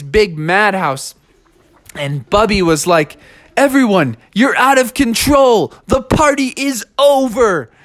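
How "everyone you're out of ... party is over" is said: high, fed-up character voice; exasperated announcement. Acted. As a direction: shut the party down, voice raised high. Fed-up host ends the party.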